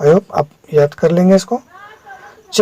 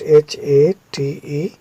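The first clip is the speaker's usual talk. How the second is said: slow spelling; 2 semitones lower, 3 dB quieter, much slower than usual. Shown as a demonstration. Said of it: "spelling out hate".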